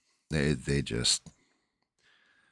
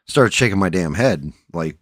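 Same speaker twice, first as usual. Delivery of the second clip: normal talking voice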